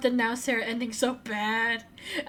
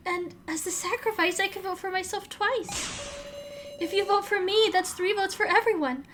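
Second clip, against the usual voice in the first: higher, urgent game heroine voice; character dialogue read; 9 semitones higher than usual. Acted. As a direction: plead for the vote, pushing higher.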